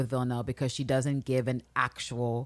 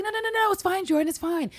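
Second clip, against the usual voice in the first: mock insistent husband. Nico insisting Jordan stays.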